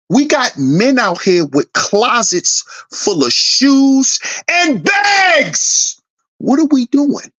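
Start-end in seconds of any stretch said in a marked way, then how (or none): 4.5-6.0 incredulous yelp. Real, not acted. Ranting about men's bags.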